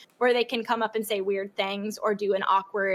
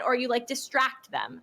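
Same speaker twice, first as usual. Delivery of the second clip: ordinary delivery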